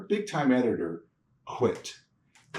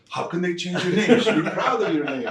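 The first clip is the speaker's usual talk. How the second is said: higher, louder indignant dad, laughing through it; laughing mock outrage; 9 semitones higher, 6 dB louder than usual. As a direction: play the offended father while cracking up. Dad outraged over name change.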